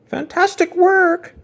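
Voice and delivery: high, slow, loud teacher's cry of praise; cried out. Acted. Mister Hoppypot praising Jasper's poster, high delighted cry. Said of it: "cried Mister Hoppypot".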